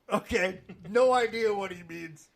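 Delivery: ordinary delivery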